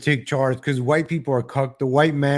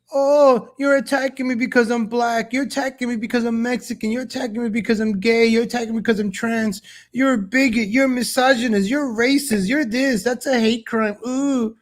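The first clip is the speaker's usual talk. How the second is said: high whiny mock victim voice, pitched up throughout; mocking a politician; 8 semitones higher than usual. Host imitating politicians crying bigot at critics, high whiny mockery. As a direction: play the wounded accuser, whining each charge. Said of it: "They like to hide".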